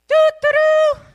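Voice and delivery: loud, high trumpet call by voice; trumpet imitation. Telling the congregation about the dawn call, loud trumpet imitation. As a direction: sound the horn with your voice. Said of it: "I heard this years ago".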